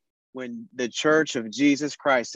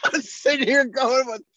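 hyped-up wrestler voice; 12 semitones higher than usual. Acted. Hyped up, laughing wrestling build-up.

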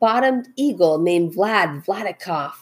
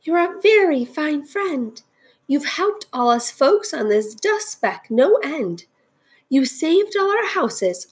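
high little voice from far down; small, high storybook character voice; tiny speck voice; 7 semitones higher than usual. A narrator's reading. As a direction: speak as a tiny person, pitched up. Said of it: "came the voice".

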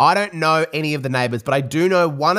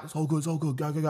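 hushed carer; 11 dB quieter, much faster than usual. Retold or imitated. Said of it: "the carer's like".

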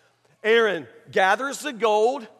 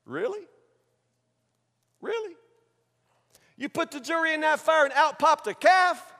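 high-pitched disbelieving questions; mock incredulous; 7 semitones higher than usual. Preacher answering Aaron's excuse, incredulous. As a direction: question it in rising, sceptical disbelief.

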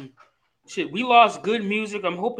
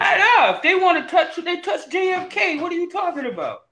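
high-pitched, incredulous pushback; animated rebuttal; 7 semitones higher than usual. Real, not acted. Arguing back at the co-host about who gets touched, incredulous. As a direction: jump in high and punchy, easing off at the end.

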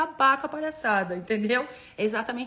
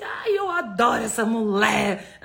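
louder, slower, laughing fan gush; imagined fan voice; 8 dB louder, slower than usual. Pretend. Coach voicing a happy viewer's body, laughing gush. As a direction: play a delighted viewer. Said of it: "falando assim".

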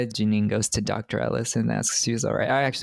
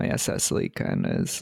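very low voice. Real, not acted. Low dropped voice.

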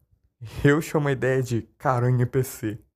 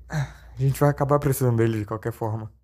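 normal talking voice

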